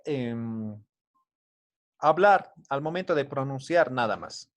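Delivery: usual speaking voice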